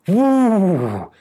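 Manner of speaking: high, loud, long whoo; storyteller's gale sound effect; howling wind. A narrator's reading. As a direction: swoop down like a gust of wind. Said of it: "Winds blew".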